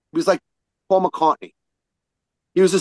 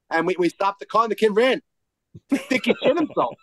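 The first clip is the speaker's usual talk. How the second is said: usual speaking voice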